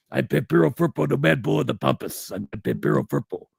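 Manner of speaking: wild-man wrestler impression; Pampero Firpo. Host shows friend how an old wrestler talked, wild-man impression. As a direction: put on an old heel's introduction voice. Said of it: "he talked like this".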